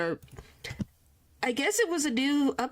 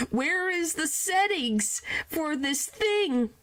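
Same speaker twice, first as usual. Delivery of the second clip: ordinary delivery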